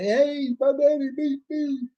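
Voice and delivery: put-on high, slow little-kid voice; mock child's complaint; child pleading. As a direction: play a small child telling on daddy. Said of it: "cry to and say".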